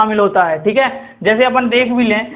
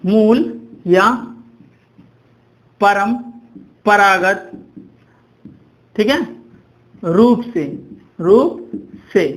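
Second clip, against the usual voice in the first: very slow, drawn-out words with long pauses; dictation pace; much slower than usual. Shown as a demonstration. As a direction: spell it out slowly for note-takers. Dictating definition for students to write. (बहुत धीरे, रुक-रुककर इमला बोलते हुए)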